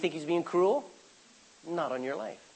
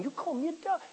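offended retort; 11 semitones higher, 6 dB quieter than usual. Retold or imitated. Preacher imagines his own offence.

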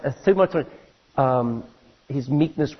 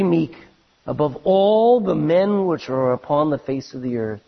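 scripture reading; slower than usual. Acted. Reads scripture with emphasis.

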